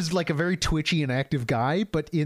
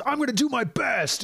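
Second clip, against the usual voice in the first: mock yell; 9 semitones higher than usual. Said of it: "And she yells".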